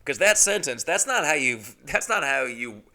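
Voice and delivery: ordinary talk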